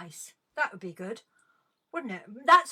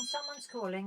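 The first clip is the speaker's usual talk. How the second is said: muttered aside; 8 dB quieter than usual. Acted. Host muttering to herself while picking up the call, softer. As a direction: repeat it much softer, under your breath.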